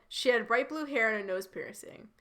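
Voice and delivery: usual speaking voice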